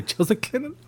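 high squeak. Pretend. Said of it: "I wanna say".

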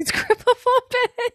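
high, slow, laughing repeat. Real, not acted. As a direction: barely hold it together. Echoing the joke title, laughing.

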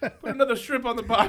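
hammed-up Australian accent; mock Aussie, laughing.

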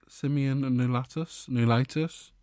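"Semian nulatus" tilting up at the end like a question; questioning rise. Real, not acted. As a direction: sound out "nulatus" unsure, ending on a rise. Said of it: "It's the way it goes up at the end".

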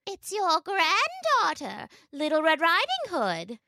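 wolf's high, drawn-out fake girl voice; mimicking a girl. A narrator's reading. Wolf fooling grandmother at the door, mimicking the girl. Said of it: "mimicking the girl's voice as best he could".

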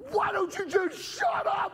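high, slow mock shout; hammed-up high-pitched scolding, stretched out; exasperated yelling. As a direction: midwife screaming at a useless husband. Acts midwife shaking and scolding him.

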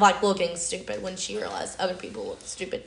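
ordinary talk, nothing marked

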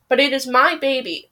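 usual speaking voice

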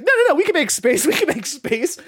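high, pushy reassurance with a laugh; eager insisting. Retold or imitated. Friend brushing off the flustered waitress, high eager insisting. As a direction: wave off the waitress's worry.

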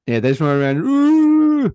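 high, slow, sarcastic drawl; mock the idea that zombies run. Mocking his mate's claim that zombies are slow, in a high, slow sarcastic voice.

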